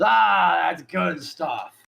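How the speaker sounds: loud drawn-out "Ah", then slow relish; shopkeeper savouring. Acted. Shopkeeper relishing his neck beard juice, loud and drawn out. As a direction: savour it in character. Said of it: "And he goes".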